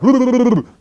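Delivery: big hearty laugh; loud high laugh. Pretend. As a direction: burst out laughing. Laughing at impossible goal.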